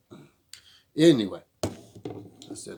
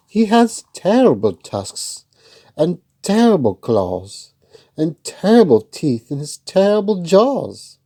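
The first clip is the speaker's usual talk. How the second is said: higher, louder little mouse, politely refusing; a brave little mouse with a higher voice; plucky mouse; 4 semitones higher, 10 dB louder than usual. Acted.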